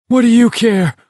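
high, loud fierce retort. A narrator's reading. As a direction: snap it back fiercely. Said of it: "Olson asked fiercely".

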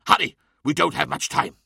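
urgent order to get help; urgent shout. Acted. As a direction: push him to move now.

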